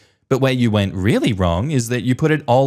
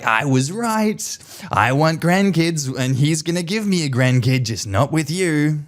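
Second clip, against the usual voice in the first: smug grandma; 5 semitones higher than usual. Retold or imitated. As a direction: savour it, high and smug. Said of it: "grandma's gonna be like".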